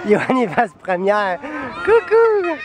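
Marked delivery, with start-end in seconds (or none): 1.8-2.6 sing-song hello. Real, not acted. Calling to the child.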